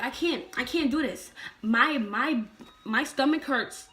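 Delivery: retold complaint, acted as if crying; crying protest. Her to dad during contractions, mock crying. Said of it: "I was, like, crying".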